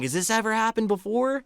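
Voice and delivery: retold worried friend's question; alarmed question. Scared friend asking about the haunting, alarmed. Frightened friend asks the host.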